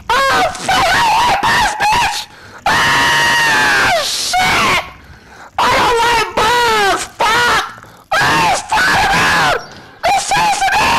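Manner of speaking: terrified high shrieking; screaming while being chased; scared horror game streamer. Real, not acted. Bug monster chasing the player.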